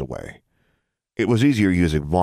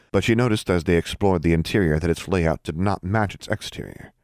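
usual speaking voice

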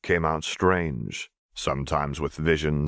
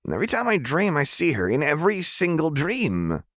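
much higher, voice straining toward tears; close to tears; 11 semitones higher than usual. Acted. Jinx telling Jericho she sees her every dream, near tears. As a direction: plead as tears well up. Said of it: "Tears were threatening in her eyes".

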